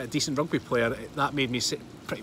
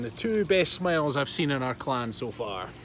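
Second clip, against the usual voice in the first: usual speaking voice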